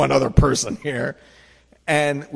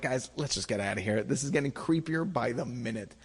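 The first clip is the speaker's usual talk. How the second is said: softer, lower urgent plea; hushed to friends; 3 semitones lower, 9 dB quieter than usual. Retold or imitated. Teller quietly urging friends to leave, soft and low. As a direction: keep it down so the guide won't hear. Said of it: "I keep turning to my friends".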